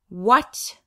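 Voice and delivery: loud startled what; shocked what. Acted. Big sister hearing it's her name, loud startled question. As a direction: jolt out the word in disbelief. Chin knocks against chest.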